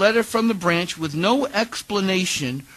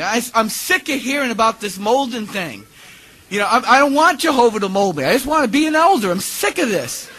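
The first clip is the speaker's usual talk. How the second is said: loud, high, fed-up protest; acted exasperation, pitched up and loud; retold frustrated outburst; 5 semitones higher, 6 dB louder than usual. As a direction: reenact losing your temper at a mentor. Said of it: "I was in such a rage".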